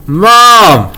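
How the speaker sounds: high, drawn-out call; urgent kid's holler; hollering for mom. A narrator's reading. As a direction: yell for mom in the next room. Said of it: "I hollered".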